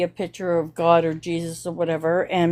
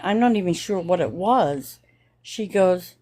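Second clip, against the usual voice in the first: usual speaking voice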